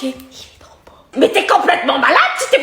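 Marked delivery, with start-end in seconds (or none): 0.0-0.9 soft unvoiced whisper of secret admiration; whispered aside. Mother secretly admiring the tattoo in a whisper. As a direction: breathe it out so the daughter doesn't hear.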